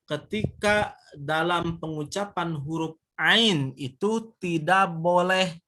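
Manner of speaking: ordinary talk, nothing marked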